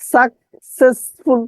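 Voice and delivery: সাকসেসফুল slow and chopped into three; distorted spelling pronunciation. Shown as a demonstration. Teacher drilling the class, chunked pronunciation. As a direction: space out "sak, ses, ful" in সাকসেসফুল. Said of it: "বিকৃত ভাবে উচ্চারণ করবেন".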